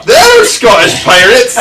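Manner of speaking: slow, loud Scottish pirate voice; Scottish pirate accent. Acted. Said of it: "So you went Scottish".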